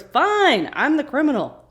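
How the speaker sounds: loud, high defiant snap; defiant outburst. Retold or imitated. Sawyer answers Saeed's accusation, loud and defiant. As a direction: throw the accusation back at them. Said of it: "And then Sawyer goes".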